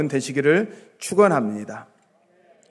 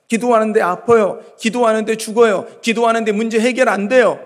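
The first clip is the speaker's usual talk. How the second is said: louder, higher, insistent list; acted taunting complaint; 6 semitones higher, 8 dB louder than usual. People taunting that prayer solves nothing, acted complaint. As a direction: hammer out each grievance in a raised voice. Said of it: "수많은 조롱이 있을 수도 있어요".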